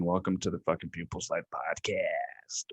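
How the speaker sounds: low breathless whisper intro; hushed podcast welcome. Acted. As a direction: whisper it like a secret.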